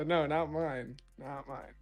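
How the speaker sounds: usual speaking voice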